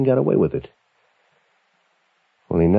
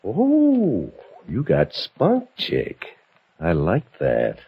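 mock impressed; much slower than usual. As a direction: act impressed, mockingly. Bully reacts to her spirit.

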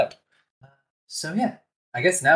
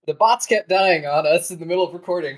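ordinary delivery

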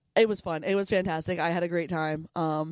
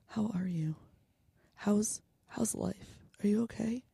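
whispered fake heart-to-heart; teasingly soft, drunk-friend concern; mock concerned whisper; 5 dB quieter, slower than usual. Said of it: "I'm not gonna look at you and be like".

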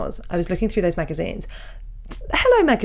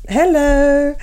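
higher, louder drawn-out "Hello" answering back; mock hello; 6 semitones higher, 6 dB louder than usual. Answering the cohost's sing-song with a stretched hello. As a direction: echo it back in a playful stretched tone.

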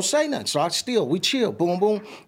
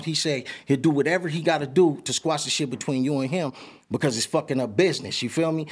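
usual speaking voice

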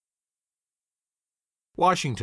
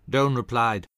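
ordinary delivery

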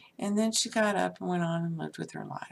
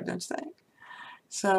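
ordinary talk, nothing marked

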